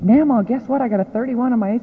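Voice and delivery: lightly voiced son, high and quick with news; half-imitate a proud boy blurting his score; mock eager teen bursting with good news; excited son. Son proudly telling grandma news.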